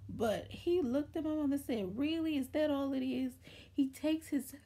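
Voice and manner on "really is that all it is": higher-pitched, incredulous question; retold dismissive doctor. Doctor looking at the stuck penny, retold disbelief. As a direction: imitate someone unimpressed by the fuss.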